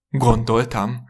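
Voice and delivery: loud, indignant snort of a word; snorted retort. A narrator's reading. Husband retorts to wife about becoming a fireman, snorting. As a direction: throw it back with a mild snort. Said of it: "horkant fel a férfi".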